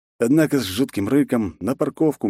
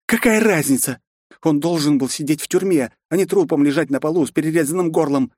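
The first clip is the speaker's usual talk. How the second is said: exclaiming; 8 semitones higher than usual. A narrator's reading. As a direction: exclaim it indignantly. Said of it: "воскликнула Аюми". (восклицая)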